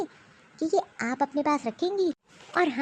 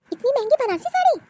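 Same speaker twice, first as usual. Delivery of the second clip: incredulous; 9 semitones higher, 4 dB louder than usual. Acted. Mother-in-law scoffs at expensive sari.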